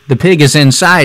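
ordinary delivery

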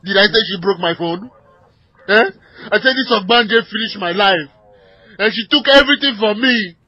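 loud, furious yelling at the dispatcher; furious shouting. Acted. Armed husband screaming at the dispatcher about his wife, furious shout. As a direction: bellow the accusations in a rage.

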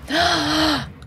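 sharp high gasp. Real, not acted. Player spots the painting, sudden gasp.

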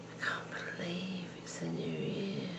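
emotional, voice dropping to a whisper; hushed and choked up; overcome with emotion. Real, not acted. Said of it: "I'm getting emotional now".

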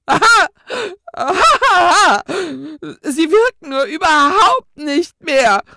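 high, loud, hysterical ghostly cackle-shout; hysterical laugh. A narrator's reading. Ghost laughs hysterically at the twins' excuse, high and loud. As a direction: laugh hysterically, half out of control. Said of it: "lachte nochmals hysterisch auf".

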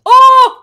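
a gut-level scream of fright; manly scream. Real, not acted. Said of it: "you have a more manly scream".

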